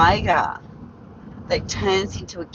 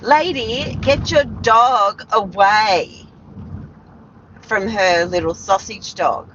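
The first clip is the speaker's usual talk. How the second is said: mild mock yell; 4 semitones higher than usual. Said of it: "yelled at me and said".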